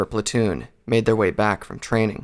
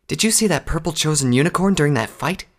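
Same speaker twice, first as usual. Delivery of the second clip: higher, eager questioning mare voice; mare recruit; 6 semitones higher than usual. Acted. Recruit asks about the unicorn in the fight, higher curious voice.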